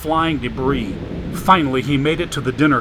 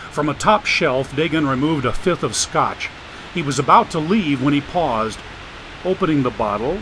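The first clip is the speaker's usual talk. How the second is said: usual speaking voice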